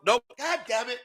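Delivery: ordinary talk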